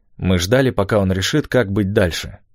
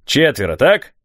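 loud, high-ending query; deafening croak; 6 semitones higher, 4 dB louder than usual. A narrator's reading. Mayor counts the arriving boys, croaking loudly. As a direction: croak it out with a sharp final lift. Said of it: "оглушительно прокаркал он".

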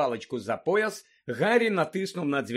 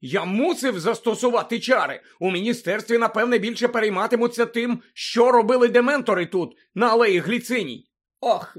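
raised, higher acted voice; agitated character dialogue; 5 semitones higher, 6 dB louder than usual. A narrator's reading. Boy and old woman upset over dementors, agitated. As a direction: fret and fume as the character.

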